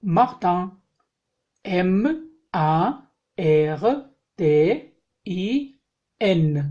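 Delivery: slow, letter-by-letter French spelling; spelling aloud. Shown as a demonstration. Teacher spelling French words for students, slow and clear. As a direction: spell each word out slowly for the class.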